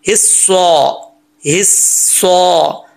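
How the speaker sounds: slow हिस्सा with held, thick s and "saw"; full-mouth saad. Shown as a demonstration. Teacher drilling saad, careful recitation. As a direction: round हिस्सा into a long full-mouthed ending. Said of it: "स्वाद को स्वाद ही पढ़ना है".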